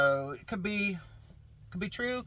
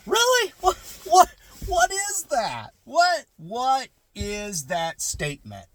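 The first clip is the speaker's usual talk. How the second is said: much higher, slowed disbelieving what; mock outrage at a dismissive phrase; high-pitched baffled really; incredulous; 10 semitones higher, 6 dB louder, slower than usual. Incredulous reaction to "that's fair.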